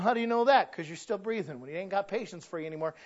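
ordinary delivery

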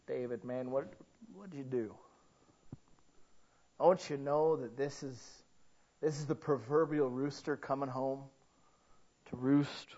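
hushed sorrowful question; quiet grieving rebuke. Retold or imitated. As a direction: gently ask David what he has done. Imagined Nathan speaking to David.

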